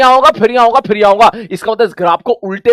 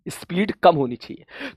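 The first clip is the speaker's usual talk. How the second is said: softer repeat; 5 semitones lower, 12 dB quieter, slower than usual. Shown as a demonstration. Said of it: "graph को ऐसे पढ़ना पड़ेगा".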